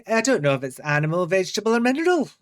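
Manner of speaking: exaggerated Scottish accent, rising high on the last words; put-on Scottish accent. Podcaster mimics Aggie's favourite saying, exaggerated Scottish accent. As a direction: imitate a Scottish catchphrase, leaping up in pitch at the end. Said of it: "I put a Scottish accent on there".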